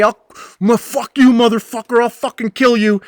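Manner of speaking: put-on high, loud drunken threat; mock drunk screaming a death threat. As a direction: scream it like you want a fight. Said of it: "starts screaming at me".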